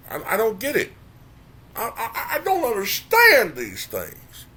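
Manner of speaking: high, loud, incredulous ranting; venting in disbelief, voice climbing; exasperated rant. Real, not acted. Incredulous rant about conservatives.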